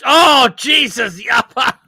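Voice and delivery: long, high, loud wail of horror; real horrified yell; appalled shout. Real, not acted. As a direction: cry out in disgust, drawn out. Appalled at next food picture.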